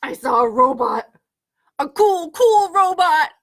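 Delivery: so excited. Acted. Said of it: "He's so excited".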